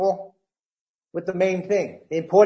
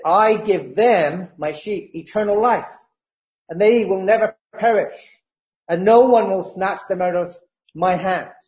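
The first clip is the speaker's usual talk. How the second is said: louder, emphatic preaching of the verse; proclaiming scripture; 3 semitones higher, 4 dB louder than usual. Acted. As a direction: declare the promise to the congregation. Reads Jesus' words at full force.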